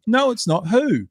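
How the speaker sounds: high, loud mock outcry; heckling Republicans. Host acts out Republicans challenging the President, mock protesting. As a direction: imitate indignant hecklers demanding an answer. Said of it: "So they're all going".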